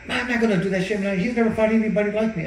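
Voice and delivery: high, loud cocky retort; cocky brother. Retold or imitated. Brother boasting back to his trainer, high cocky retort. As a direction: brush it off like a cocky fighter.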